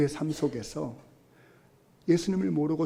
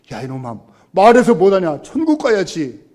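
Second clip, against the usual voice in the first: father's retort; 3 semitones higher, 13 dB louder than usual. Retold or imitated.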